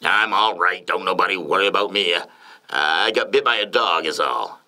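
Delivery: lightly voiced old politician; former president. A narrator's reading. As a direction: brush off the worry in a light character voice. LBJ brushes off wife's worry.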